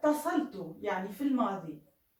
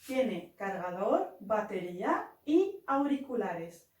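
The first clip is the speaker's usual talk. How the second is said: slow dictation; much slower than usual. Shown as a demonstration. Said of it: "الآن كيف نقول سماعات؟".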